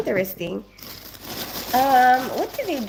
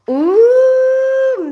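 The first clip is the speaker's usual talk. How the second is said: excited squeal; 14 semitones higher, 10 dB louder than usual. Real, not acted. Reacts to question about crumpet.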